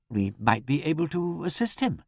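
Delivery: high and hopeful, then fading out; hopeful, trailing away. A narrator's reading. Vicar offering help, voice trailing away. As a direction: start eagerly and let it die away. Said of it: "His voice trailed away".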